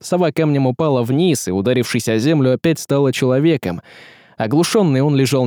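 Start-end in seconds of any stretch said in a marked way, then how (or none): none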